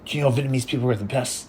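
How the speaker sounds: puffed-up father bragging about his people; proud dad. Retold or imitated. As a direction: brag like a proud Vietnamese dad. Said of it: "My dad was like".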